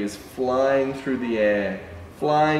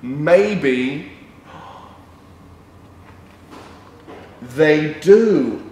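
louder, triumphant reveal; big reveal; 3 semitones higher, 8 dB louder, much slower than usual. Pretend. Revealing to children the dog climbs, louder triumphant. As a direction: announce it like a surprise.

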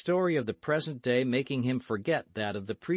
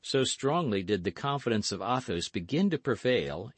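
ordinary delivery

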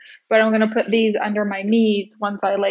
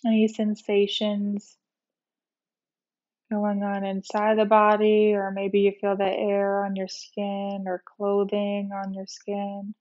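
guided meditation; 4 dB quieter than usual. Real, not acted. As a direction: ease them gently into rest, unhurried. Leads a guided relaxation meditation.

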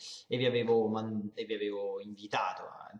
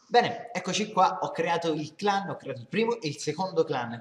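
excited; 6 semitones higher, 6 dB louder than usual. Real, not acted. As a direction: come back in with a burst of energy. Back on camera, energetic. (tornato entusiasta, voce alta e scandita)

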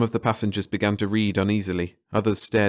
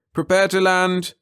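pilot over intercom; 11 semitones higher, 5 dB louder than usual. Acted. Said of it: "the pilot's voice came".

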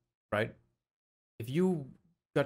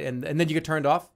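ordinary talk

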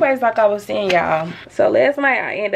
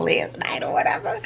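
whispered aside; 2 semitones lower, 4 dB quieter than usual. Real, not acted. Telling viewers the story is over, in a whisper. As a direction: hush it to the viewers. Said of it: "unvoiced whisper".

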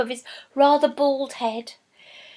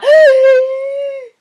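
sharp high loud gasp; a startled gasp from behind the pillar; shocked gasp; 14 semitones higher, 11 dB louder than usual. A narrator's reading. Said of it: "a gasp from behind".

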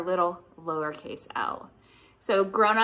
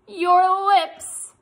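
silly face; 10 semitones higher, 11 dB louder than usual. Shown as a demonstration. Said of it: "would make this silly face".

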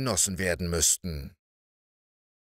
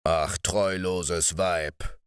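indignant giant; 4 semitones lower, much slower than usual. Acted. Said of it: "schrie er sichtlich entrüstet".

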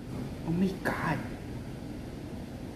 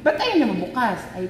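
ordinary talk

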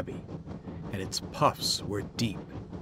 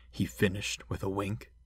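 plain reading voice

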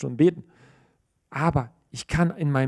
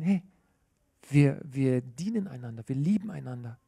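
gentle parent; 4 semitones lower, 5 dB quieter than usual. Retold or imitated. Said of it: "sondern ich sag ihnen".

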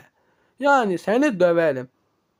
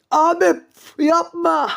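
high pleading; 8 semitones higher than usual. Acted. Victim begs attacker.

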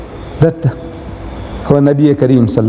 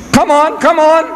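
high-pitched, insistent urging; impatient goading; 11 semitones higher than usual. Retold or imitated. Reverend to the silent Maulana, impatient goading. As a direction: goad him from high in the voice.

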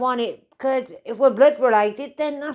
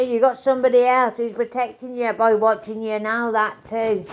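ordinary delivery

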